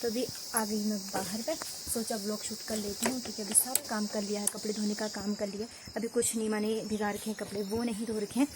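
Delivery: speaking softly. Real, not acted. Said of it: "तो मैं आराम से बोल रही हूँ".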